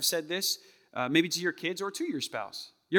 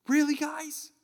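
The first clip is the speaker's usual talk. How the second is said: exasperated; 11 semitones higher, slower than usual. Acted. Jesus finds disciples asleep.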